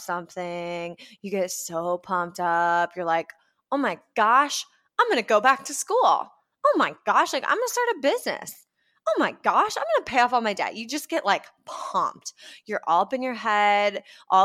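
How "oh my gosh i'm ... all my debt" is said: higher, fast, gushing "oh my gosh"; giddy, rushing excitement; hyped gushing. Retold or imitated. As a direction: bubble over with big plans. Mimicking hyped self.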